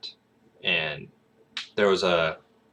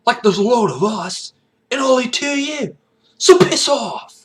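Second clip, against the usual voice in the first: high, loud Cockney street thug; Cockney accent; 9 dB louder than usual. Acted. Thugs on the street warning someone off, aggressive Cockney accent. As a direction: jeer in a put-on Cockney voice. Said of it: "Cockney. So kinda like your".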